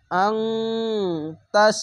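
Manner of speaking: "আং" hummed long before "তাস"; nasal ghunna. Shown as a demonstration. Teacher joining the letters with ikhfa ghunna, nasal hum held. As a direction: hold the ng of "আং", then hit "তাস". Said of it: "ইকফা গুন্না".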